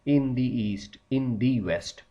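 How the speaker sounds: very slow, word by word, for note-taking; slow dictation. Shown as a demonstration. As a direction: spell it out slowly so students can write. Dictating examples slowly.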